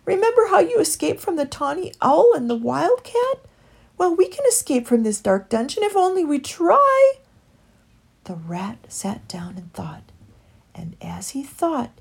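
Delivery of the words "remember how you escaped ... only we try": high, loud, urgent encouragement; earnest coaxing, voice lifted; pleading. A narrator's reading. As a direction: urge a despairing friend on. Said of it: "begged Heathly".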